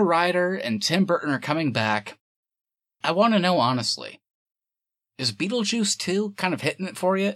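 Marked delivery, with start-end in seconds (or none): none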